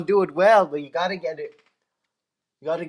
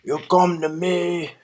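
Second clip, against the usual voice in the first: Godfather impression. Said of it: "my impression".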